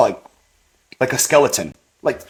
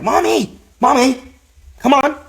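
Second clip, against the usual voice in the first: put-on high, louder child calling his mom; little kid pleading; 13 semitones higher, 4 dB louder than usual. Young son urging his waking mom, high and loud. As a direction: call out like an eager small boy. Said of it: "And I'm like".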